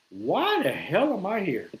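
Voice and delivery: slow, put-on bewildered voice; mock horror at the party; wondering why you came; disgusted disbelief. Party-goer regretting coming in.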